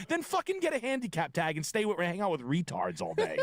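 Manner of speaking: high-pitched mock outrage; exasperated rant. Comic rant at people saying what.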